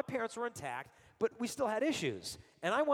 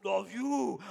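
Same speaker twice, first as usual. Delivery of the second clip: higher, louder startled dad voice; surprised dad; 5 semitones higher, 6 dB louder, much slower than usual. Retold or imitated. Father surprised by son's love you at breakfast. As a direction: catch him off guard, then soften. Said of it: "He was like".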